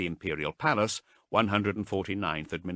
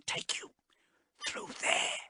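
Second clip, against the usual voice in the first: much softer, hushed whisper of dread; 6 dB quieter than usual. A narrator's reading. Marast whispering the horror to Haas, hushed. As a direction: breathe it out, afraid.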